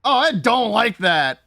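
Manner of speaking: loud, high panicked shouting; freaked out by a jump scare; scared horror game streamer. Real, not acted. Scary part incoming.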